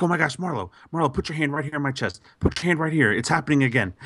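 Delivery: high-pitched, urgent pleading, mildly acted; retold panic. Retelling his urgent plea to wife.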